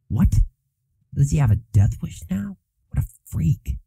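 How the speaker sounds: whispered, scornful student; sneering gossip. Acted. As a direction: sneer it under your breath.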